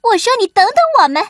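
high, fast, loud calling out; shouting after him. Acted. Carmen shouts after the fleeing sheep, fast high yell. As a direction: yell to a friend running away. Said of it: "卡门在后面大喊".

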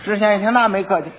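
usual speaking voice

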